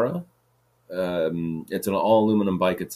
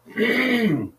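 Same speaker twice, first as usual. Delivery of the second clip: long, hard throat clear; real throat-clearing noise. Real, not acted. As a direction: clear your throat forcefully, drawn out. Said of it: "I have to clear my throat".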